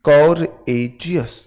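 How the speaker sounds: कोरेजियस slowly, in three syllables. Shown as a demonstration. Teacher counts out three syllables for the class. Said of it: "कोरेजियस three syllable word है".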